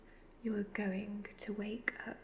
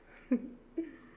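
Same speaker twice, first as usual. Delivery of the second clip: higher wicked cackle; evil cackle; 4 semitones higher than usual. Acted. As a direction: cackle at the thought of fresh youth. Devil plans to steal youth.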